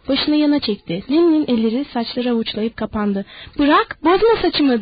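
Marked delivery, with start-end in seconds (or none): 3.6-4.8 higher, louder and slow; frightened woman shouting at Lenny. Acted. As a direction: yell it as she struggles. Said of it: "diye bağırdı kadın".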